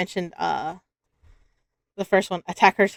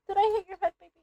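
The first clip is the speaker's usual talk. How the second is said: softer concerned coo; 11 semitones higher, 8 dB quieter, much faster than usual. Real, not acted. Repeats worried question softer.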